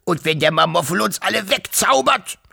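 lightly voiced grunting uncle; a hint of a grunt. Uncle grunting a worried question to the family. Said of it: "grunzte der Wawuschelonkel".

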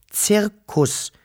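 put-on high, correcting "Zirkus" from the girl; girl correcting the little creature's mistake. A narrator's reading. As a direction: set the word right, girlishly. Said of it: "verbesserte das Menschenmädchen".

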